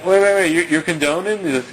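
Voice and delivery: high, loud rushed objection; alarmed objection. Retold or imitated. Arguing with the guy about the war, shocked and loud. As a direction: cut him off in disbelief. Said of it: "so it's like".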